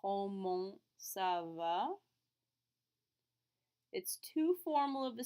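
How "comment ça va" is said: Comment ça va? said very slowly, va rising; slow repeat. Shown as a demonstration. Teacher repeats the question very slowly for students. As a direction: drag comment ça va? out for students to copy. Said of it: "the best choice here".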